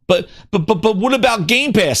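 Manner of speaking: repeated buts, high pleading question; Phil, stammering protest. Acted.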